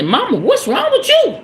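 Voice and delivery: high, swooping imitation of her child; high-pitched mock-incredulous child; child challenging mama. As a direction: mimic your kid questioning you, pitch jumping up. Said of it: "Let my baby say".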